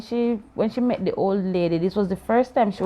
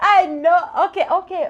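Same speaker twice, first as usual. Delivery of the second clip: laughing through the words; giggling while conceding the point; 9 semitones higher, 6 dB louder than usual. Real, not acted. Laughs at the child's reply.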